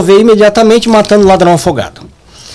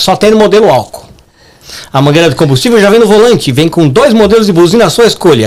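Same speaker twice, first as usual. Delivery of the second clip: ordinary talk, nothing marked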